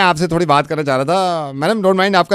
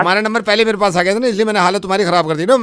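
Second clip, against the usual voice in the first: ordinary delivery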